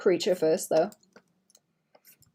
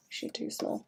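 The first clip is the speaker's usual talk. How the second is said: whispered; 14 dB quieter than usual. Real, not acted. Muttering to herself.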